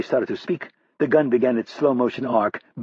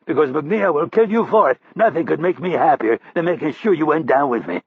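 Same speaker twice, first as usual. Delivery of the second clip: higher, louder fierce challenge; defiant taunt; 5 semitones higher, 3 dB louder than usual. Acted. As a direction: spit the threat back at him. Defiant prisoner taunts captor.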